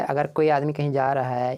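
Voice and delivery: normal talking voice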